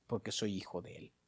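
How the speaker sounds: dropped to a hush. Acted. Softly affirming identity.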